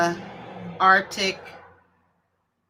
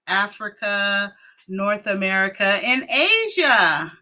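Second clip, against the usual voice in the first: usual speaking voice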